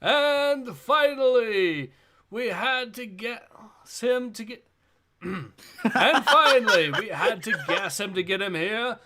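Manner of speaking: lightly voiced arena announcer. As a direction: present the fighters like a show host. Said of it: "I'll do the voice of the announcer here".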